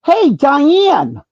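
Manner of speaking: drawn-out chummy hey; overly familiar greeting. Retold or imitated. To a star in the ticket line, overly familiar hello. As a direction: greet her like an old best friend. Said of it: "like we're best friends".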